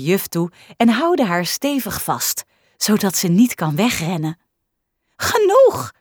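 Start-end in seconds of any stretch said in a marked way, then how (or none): 5.2-5.9 high, loud shriek of protest. Acted. Teacher shrieking at the kids spraying her, high and loud. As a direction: squeal it out, soaked and laughing. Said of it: "gilt juf Inge".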